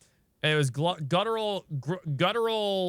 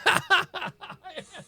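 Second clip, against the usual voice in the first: giggling fit at a weird sound; high giggle; 13 semitones higher than usual. Real, not acted. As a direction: burst of real high-pitched laughter. Laughs at own failed attempt.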